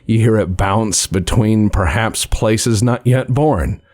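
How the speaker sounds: usual speaking voice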